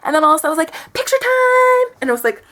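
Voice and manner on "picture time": very high, loud, drawn-out singsong; imitating overeager date. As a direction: sing it out like a giddy photo pest. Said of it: "he actually spoke like this".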